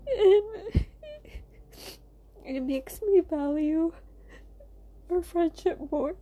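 choked up, real tears; crying. Real, not acted. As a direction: voice breaking into tears. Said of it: "I just feel sad and emotional right now".

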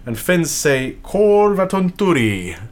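Korvatunturi sounded out slowly; careful Finnish pronunciation. Real, not acted. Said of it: "I'm about to butcher some Norse words".

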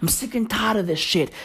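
normal talking voice

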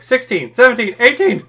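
high, loud count climbing with each number; imitated counting shout. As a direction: call out a mock battle tally. Quoting character's counting callback.

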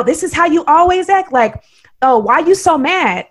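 mocking, high-pitched grievance; imitated whiny protest. Acts out the man's complaint.

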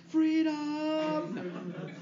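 high hammed-up war cry, laughing; mock Braveheart sword cry; playful imitation of a movie battle cry. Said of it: "he's holding up the sword".

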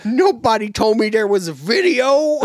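high, clueless doofus; high goofy voice. Retold or imitated. Voicing the clueless presenter.

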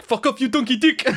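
hammed-up annoyed yell; mock South African outburst. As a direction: imitate a South African gamer losing it. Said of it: "he was like".

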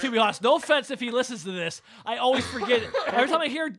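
talking through laughter; laughing. Real, not acted. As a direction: laugh while you admit it. Laughing at own forgetfulness.

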